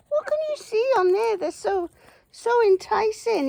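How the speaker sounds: high, fast cooing question. Real, not acted. Woman teases her goose pecking at her jeans, high and fast. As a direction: coo up high at the nibbling goose.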